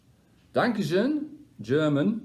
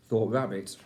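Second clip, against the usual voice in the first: ordinary delivery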